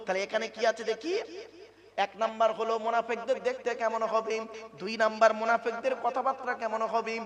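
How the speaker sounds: loud, driving sermon delivery; preaching at full force. Acted. Preacher pressing the congregation, full-force preaching. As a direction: preach it hard to the crowd.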